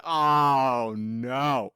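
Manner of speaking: low, drawn-out string of noes; imitating a deep groan of dismay. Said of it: "I was like".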